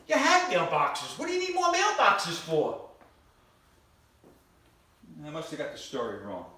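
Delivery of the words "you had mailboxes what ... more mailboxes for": high, loud outraged disbelief; hammed-up indignant yell; incredulous rant. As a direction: scold them in mock outrage. Comic rant at the audience.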